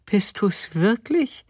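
high, slow, incredulous question; astonished wife. Acted. Wife bumping into her returning husband, high astonished question. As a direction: ask in high-pitched disbelief, drawn out.

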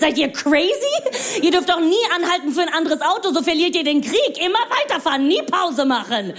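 high, incredulous ranting; hammed-up frantic protest, pitched high; mock outrage. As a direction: rant as if the rule were madness. Mock outrage at zipper merging.

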